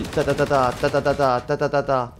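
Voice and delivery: rapid mouth gunfire ta ta ta; cowboy gun noises. Retold or imitated. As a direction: imitate a cowboy shooting. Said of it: "je devrais faire tata comme les cowboys".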